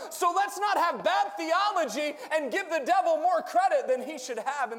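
preaching. Acted. Preaching at full force.

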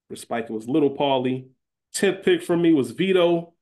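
ordinary talk

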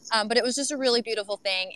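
ordinary delivery

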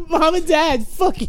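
high-pitched mock kid tantrum; mad at parents. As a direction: pitch it way up as an angry child. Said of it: "you were mad at your parents".

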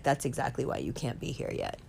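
Johnny Depp softly repeating she can't stay yet; hushed calm reply. Retold or imitated. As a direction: murmur it gently, as final word. Johnny Depp repeats his answer.

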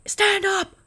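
high, loud mock yell; Jesse yelling. Partner on the steep trail, shouting angrily at her. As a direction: bark the order like an angry riding coach. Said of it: "Jesse gets mad".

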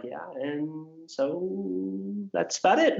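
slow drawn-out trailing voice that jumps up at the end; mock an unsure adult fumbling the ending; lightly voiced nervous speaker; awkward speaker.

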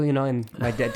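usual speaking voice

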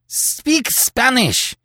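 high, slow, pleading cry; a passionate outburst, high and drawn out. Acted. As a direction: cry it out in frustration. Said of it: "cried Terry passionately".

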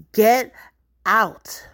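loud, high two-word shout; shouted order. Acted. Woman ordering her lover out, shouting. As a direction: bark him out of the house. Acted quarrel, ordering him out.

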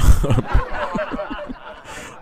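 breathy high giggle, almost silent; laughing at his own joke on stage; high wheezing laugh. Real, not acted.

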